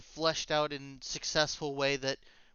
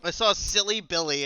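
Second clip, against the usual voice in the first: ordinary delivery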